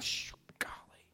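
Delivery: hushed, sheepish whisper; guilty whisper. Retold or imitated. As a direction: whisper it like caught out. Crowd slinking away guiltily.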